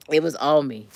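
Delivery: usual speaking voice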